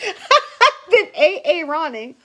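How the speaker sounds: laughing through the words. Real, not acted. Laughing at herself for mangling names. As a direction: giggle out the joke.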